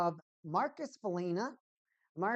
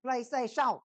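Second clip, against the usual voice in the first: loud, higher French-style PlayStation; exclaimed mock French; 7 semitones higher, 7 dB louder than usual. Streamer firing up the PlayStation, loud mock-French shout. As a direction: call it out louder, playfully Frenchified.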